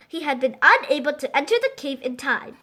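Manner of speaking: ordinary delivery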